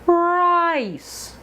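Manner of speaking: "Rice" with a long gliding vowel, high then falling; teacher models the stretched vowel in "Rice"; long glide. Shown as a demonstration. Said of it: "Really glide across the mouth".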